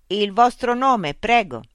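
high, loud, slow loudspeaker machine. Acted. Loudspeaker robot voice addressing Mitch. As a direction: speak as a polite, mechanical information unit.

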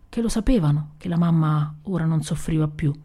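plain reading voice

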